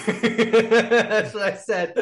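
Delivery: high slow laugh-through line; real laughter breaking the words. Real, not acted. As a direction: laugh it out, almost squeaking. Laughing at his own story.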